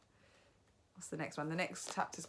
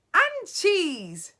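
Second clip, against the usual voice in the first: loud high bark; shrieking witch shout; 13 semitones higher, 14 dB louder than usual. A narrator's reading. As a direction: hurl it at the witches. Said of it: "shouted the Grand High Witch".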